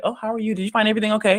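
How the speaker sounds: high, sing-song customer-service voice; chirpy American cashier. Retold or imitated. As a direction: play an overly friendly checkout clerk.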